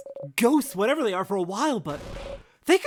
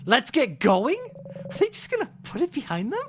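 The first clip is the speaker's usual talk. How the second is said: louder, rising in disbelief; incredulous echo; 8 semitones higher, 3 dB louder than usual. Acted. Player repeating the leader's line in loud disbelief. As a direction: throw the words back, can't believe it.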